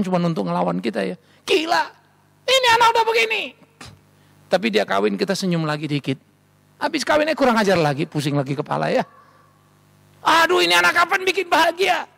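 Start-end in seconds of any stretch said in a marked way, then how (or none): 1.5-3.4 high, loud shocked outcry; acted exclamation, pitched way up; exasperated parent. Acting parent shocked at teenage child. 10.3-12.1 high-pitched wailing complaint; acted parental despair, pitched way up; exasperated parent.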